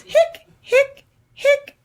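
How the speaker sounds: high squeaky hic hic hic; squeaky hiccups. Acted. Skeleton hiccuping to the kids, high squeaky hics.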